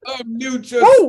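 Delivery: high, loud hyped shout; excited cheer. Real, not acted. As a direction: cheer the poet on. Host cheering after the poem.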